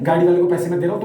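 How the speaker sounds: ordinary delivery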